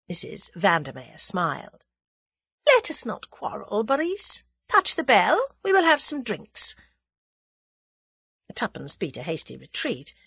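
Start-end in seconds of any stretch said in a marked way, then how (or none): 2.6-6.7 high, poised lady's voice; smooth the quarrel over; breezy, in-command hostess; conciliatory villainess. A narrator's reading.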